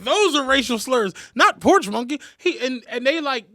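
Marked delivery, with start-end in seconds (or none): 0.0-2.2 loud, high, drawn-out retort; indignant insistence. Retold or imitated. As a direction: argue the point at high pitch. Retold movie character insisting, laughing scene.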